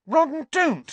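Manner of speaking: loud, very high cry; indignant high-pitched protest; angry. A narrator's reading. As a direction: shriek the reproach. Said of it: "said Hermione angrily".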